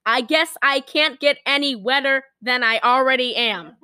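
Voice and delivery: ordinary talk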